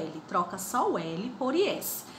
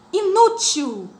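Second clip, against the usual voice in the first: Inútil with the nu louder and higher; stress on nu; 10 semitones higher, 9 dB louder, slower than usual. Shown as a demonstration. Teacher to fifth grade, stressing nu in Inútil. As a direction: lean on the nu in Inútil. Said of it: "Olha o nu como soa mais".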